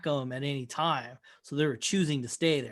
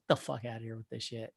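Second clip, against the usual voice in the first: softer, lower and fast, brushing it off; mock dismissive; 8 semitones lower, 11 dB quieter, faster than usual. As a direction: wave him off, quick and quiet, fed up. Host dismissing the cult leader.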